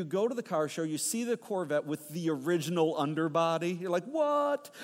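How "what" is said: high-pitched mock amazement; high, incredulous outburst; astonished what. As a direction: react like a car nut stunned by a find. Car fan reacting to rare find.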